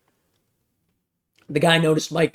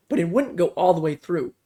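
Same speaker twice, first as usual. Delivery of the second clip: plain reading voice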